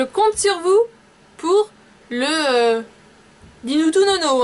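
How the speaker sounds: eager high sign-off call; excited, high and loud. Real, not acted. Eager sign-off asking for questions.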